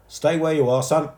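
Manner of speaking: high, loud firm call. A narrator's reading. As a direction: call up to the stuck boy. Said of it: "called one of the men".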